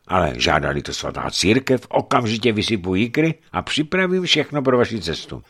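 usual speaking voice

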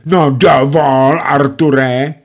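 a scandalised wife's objection; indignant wife. A narrator's reading. Wife reaches for the ball, indignant protest.

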